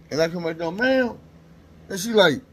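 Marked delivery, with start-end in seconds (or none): 0.8-1.2 much higher, carrying reply; answering call. Retold or imitated. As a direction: call down politely. Answers nurse calling from below.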